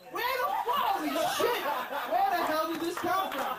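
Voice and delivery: high-pitched mock outrage; startled wife. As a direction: imitate a wife's shocked discovery, voice shooting up. Wife's startled discovery acted out.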